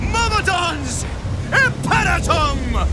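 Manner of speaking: high shouted incantation; commanding incantation. Acted. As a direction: call the spell out. Wizard summoning in battle.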